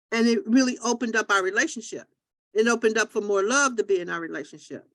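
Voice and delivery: usual speaking voice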